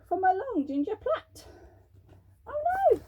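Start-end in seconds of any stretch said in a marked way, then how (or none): 2.5-3.0 high squeaky cry of dismay; a high panicky witch voice; alarmed witch. Acted. Witch loses her bow.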